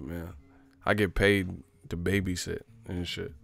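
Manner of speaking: ordinary talk, nothing marked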